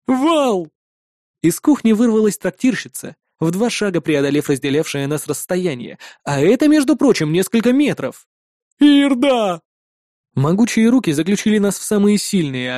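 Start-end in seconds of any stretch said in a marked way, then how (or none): 0.1-0.7 high-pitched called-out name; a contained version of a booming shout; thunderous yell. A narrator's reading. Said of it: "моментально нарушил громовой вопль". 8.8-9.6 high, drawn-out called name; raised-voice excited greeting; excited yell. A narrator's reading. Innkeeper yells greeting to friend.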